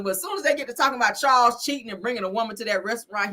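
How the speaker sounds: ordinary delivery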